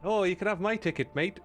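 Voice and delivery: Canadian attempt sliding into Irish; bad Canadian accent. Streamer offering a ticket as a too-nice Canadian, botched Canadian-Irish accent. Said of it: "I can't even do a Canadian accent".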